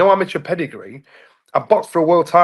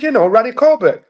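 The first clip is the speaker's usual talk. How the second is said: high, louder Dominican-accented imitation; father's Dominican accent; 9 semitones higher, 5 dB louder than usual. Dominican dad names the comedian to his son, imitated accent. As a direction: imitate a Dominican dad saying RANNY for Ronnie. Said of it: "Yeah, it's the accent".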